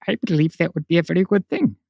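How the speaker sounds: quick, self-mocking put-on Indian accent, Mork style; a fast, hammed-up Indian accent played for a laugh; Robin Williams mock Indian accent. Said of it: "my shitty, uh, impression of Robin Williams".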